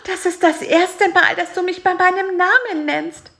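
high, loud, moved; overwhelmed at hearing his name; touched high fly voice. A narrator's reading. Fly Bisi, touched to be named, high and loud.

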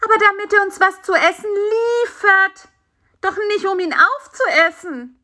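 high, urgent character voice; exasperated little fly protesting; indignant protest. Acted. Fly protests the spider's plan.

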